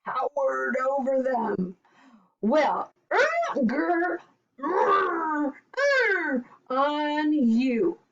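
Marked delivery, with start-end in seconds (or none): 2.4-8.0 high growls mocking the animals; yelling giant. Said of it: "he yelled".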